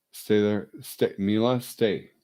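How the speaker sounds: loud, firm dog command. Real, not acted. Owner sternly telling dog Mila to stay, loud and firm.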